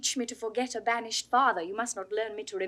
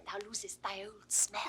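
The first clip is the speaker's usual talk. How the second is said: whispered tease; 3 semitones lower, 7 dB quieter than usual. Acted. Teasing hushed aside.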